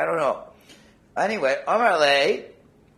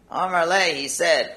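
usual speaking voice

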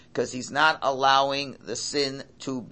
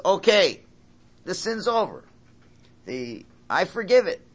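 higher, louder mock declaration; voicing forgiveness; 7 semitones higher, 7 dB louder than usual. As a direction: announce it like forgiveness itself. Forgiveness personified speaks.

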